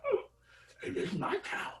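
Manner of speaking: high, breathless whisper; a dead mouth rasping it out; ghostly whisper. Acted. Puppeted corpse speaks.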